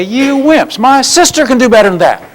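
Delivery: high, teasing jeer; mocking taunt. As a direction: needle them like a drill coach. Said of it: "And I would say".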